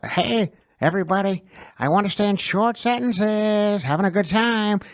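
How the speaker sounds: put-on high, bouncy party-girl voice in short bursts; Caitlyn impression. Shown as a demonstration. Host demonstrating his Caitlyn impression to cohost. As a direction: chirp it like a bubbly influencer. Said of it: "when you're doing Caitlyn".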